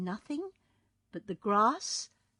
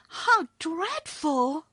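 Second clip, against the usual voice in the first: put-on higher, louder horrified girl; horrified cry; 11 semitones higher, 7 dB louder, much slower than usual. A narrator's reading. Dorothy hearing of Ozma's prison, horrified. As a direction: gasp it out in horror.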